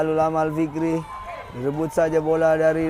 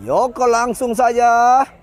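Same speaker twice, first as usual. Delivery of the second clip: excited call; 5 semitones higher, 6 dB louder, slower than usual. Real, not acted. Commentator calling a free kick.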